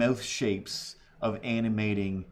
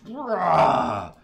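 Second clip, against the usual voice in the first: short loud rah; mock scream; 7 semitones higher, 7 dB louder than usual. Vlogger mimicking the neighbour with a loud mock rah. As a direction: bark out a hammed-up scream.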